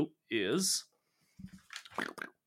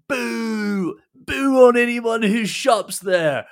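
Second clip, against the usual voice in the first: a picketing dwarf booing the shoppers; ranting dwarf protester; 7 semitones higher, 9 dB louder than usual. Acted. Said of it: "one of them says".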